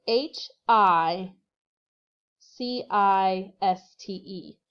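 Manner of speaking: letters spelled slowly one by one; slow, careful spelling. Shown as a demonstration. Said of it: "it is gonna be hiciste, so".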